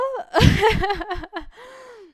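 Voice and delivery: high, loud burst of laughter. Real, not acted. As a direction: laugh out hard. Laughs after the outburst.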